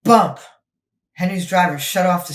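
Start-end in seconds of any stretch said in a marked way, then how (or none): 0.0-0.4 loud bump. A narrator's reading. Sudden bump in tunnel.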